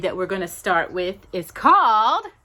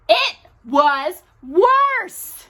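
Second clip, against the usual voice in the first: slow and louder, drawn out; dramatic emphasis; 7 semitones higher, 5 dB louder, much slower than usual. A narrator's reading. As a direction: land each word heavily. Dramatic reading.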